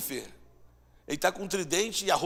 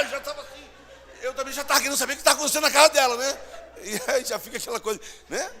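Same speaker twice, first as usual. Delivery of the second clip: louder, fast and laughing; laughing while telling; 9 semitones higher, 8 dB louder, faster than usual. Real, not acted. Preacher laughing at the story to the congregation, fast. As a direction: rush through it laughing.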